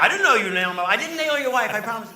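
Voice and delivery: high and loud, tripping over the words; flustered protest. Retold or imitated. Comic denies the joke to her husband, loud and flustered. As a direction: hurry to deny it, rattled.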